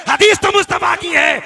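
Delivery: loud, high insistent objection; emphatic high-pitched protest; shouted retort. Acted. Acts out objector's insistent reply.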